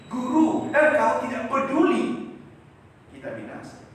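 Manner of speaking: high, loud appeal fading at the end; loud cry. Retold or imitated. Disciples cry to Jesus, loud then fading.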